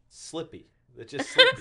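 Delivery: slippery clipped to slippy, rising into a laugh; mock Pennsylvania slippy. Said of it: "but slippery".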